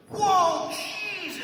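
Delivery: high, loud startled yelp; startled character. Retold or imitated. My character startled by the fire blast, high yelp. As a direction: yelp like a spooked goofball in the movie. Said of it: "my character goes".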